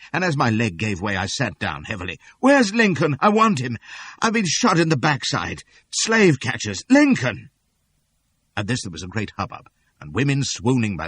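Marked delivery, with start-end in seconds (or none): 2.4-7.5 desperate shouting. A narrator's reading. Wounded man calling for Lincoln.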